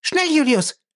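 high, piercing cry for help; high-pitched urgent call; urgent scream. A narrator's reading. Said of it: "schrie sie".

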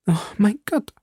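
high, sighing lament; hopeless sigh; despairing sigh. A narrator's reading. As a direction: let it sink out of her in despair. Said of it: "seufzte Madame Bonacieux".

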